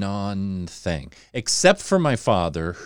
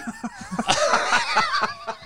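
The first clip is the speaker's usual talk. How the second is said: a high, bright burst of laughter. Real, not acted. Laughing at own story.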